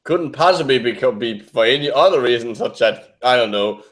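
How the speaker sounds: ordinary talk, nothing marked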